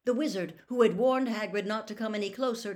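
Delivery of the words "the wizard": usual speaking voice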